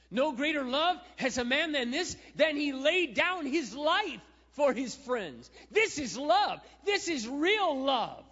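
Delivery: high, loud, slow preached proclamation; preaching at full force. Acted. Preacher crying out to the congregation, high and loud. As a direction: proclaim it to the whole church.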